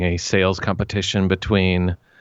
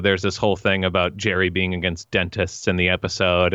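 ordinary delivery

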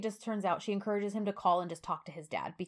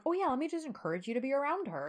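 high, sarcastic fake-agreeable voice; mock a fake cheerful yes, dripping sarcasm; sarcastic mock reply; 6 semitones higher, faster than usual. Mock hypothetical reply to rival.

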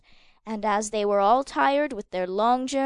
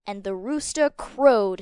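ordinary delivery

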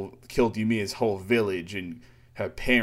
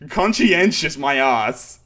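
scornful mockery; 3 semitones higher, 6 dB louder, slower than usual. Real, not acted. Host mocking a manga character's word choice, loud scorn. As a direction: scoff at the word Conscientious, loud.

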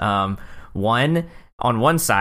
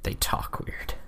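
whispered; 9 dB quieter than usual. Acted. Echoing in odd voice.

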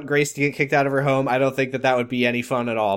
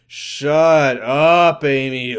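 louder, mock sing-song shut up; imitating Ben; 4 dB louder, much slower than usual. Retelling how Ben told Amy off, mock sing-song. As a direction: mimic his sing-song dismissal. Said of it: "this is how he says it".

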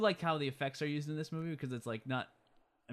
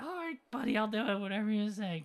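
put-on much higher meek kid voice; mock pushover; 8 semitones higher than usual. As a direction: cave in like a timid kid. Pushover Marty Junior agreeing.

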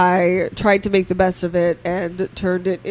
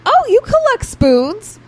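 much higher, louder, chirpy mom; mom's voice; 13 semitones higher, 4 dB louder than usual. Retold or imitated. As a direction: mimic the cheerful mom's question. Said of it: "she's like".

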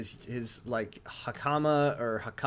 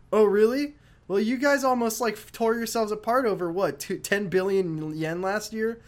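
mock taunting; 7 semitones higher, 3 dB louder than usual. Ryuji taunting Kashiwagi.

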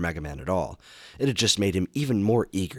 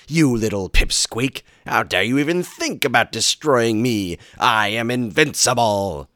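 megalomaniac rant; 6 semitones higher, 9 dB louder than usual. Acted. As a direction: roar like a mad genius. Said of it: "the evil genius roared".

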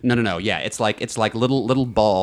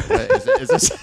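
laughing. Real, not acted. Laugh mid-quote.

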